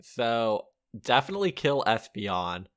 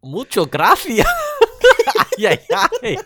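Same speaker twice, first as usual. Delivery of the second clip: loud, higher hammed-up Spanish accent dad; mock Spanish accent; 12 dB louder than usual. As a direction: belt out a cartoonish Spanish accent, playing the dad. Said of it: "you have to do the accent too".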